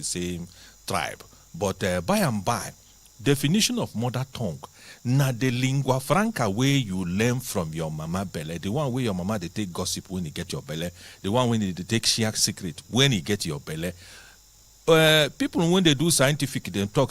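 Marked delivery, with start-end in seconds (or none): none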